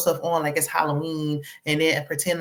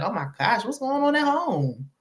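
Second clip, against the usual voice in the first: mock alarm; 6 semitones higher than usual. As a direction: imitate a scandalised bystander. Imagined shocked onlookers reacting to crying.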